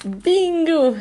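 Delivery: high, loud cry of victory; triumphant bingo. Real, not acted. Finding the card she hoped for, she cheers. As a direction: punch it like you won.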